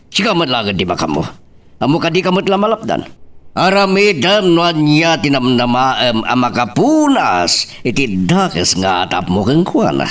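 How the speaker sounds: normal talking voice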